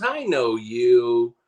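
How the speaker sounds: high, drawn-out mock recognition; retold nosy stranger. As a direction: imitate a guy slowly placing someone he knows.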